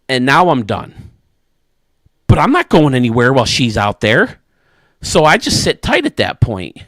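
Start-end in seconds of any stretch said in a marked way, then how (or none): none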